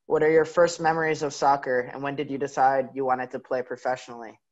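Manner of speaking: ordinary talk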